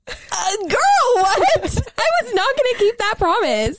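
mock disbelief. Mocking her own broken promise.

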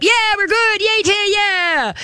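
very high, slow, giddy voice of an overexcited dog. Pretend. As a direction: squeal like a puppy who just got released. Dog voiced excitedly breaking sit.

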